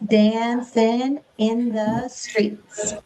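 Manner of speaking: very slow, evenly paced; slow rhythmic say. Shown as a demonstration. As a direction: say it slowly to the beat. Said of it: "Will you say that with me".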